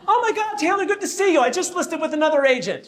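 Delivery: high gushing with a laugh; mock starstruck client; imitating a high gushy client.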